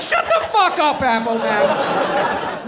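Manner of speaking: loud, high group-style rebuke; hammed-up exasperated outburst; angry shout. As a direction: yell it, dragging out the name. Everyone turns on Apple Maps.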